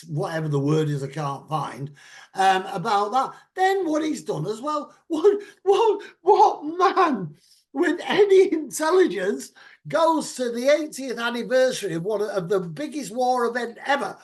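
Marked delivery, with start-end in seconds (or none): 3.5-14.2 loud, incredulous rant; worked-up, exasperated outburst. Real, not acted. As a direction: rant it out, pitched high and loud. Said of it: "I was just having a rant".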